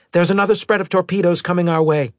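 fast, high, loud warning; urgent alarm. Acted. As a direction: rattle out the warning to the crew. Crew member warns of torpedoes.

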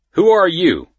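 high, loud challenge; sharp demand. A narrator's reading. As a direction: demand it like the man in charge. Man in suit singles prisoner out.

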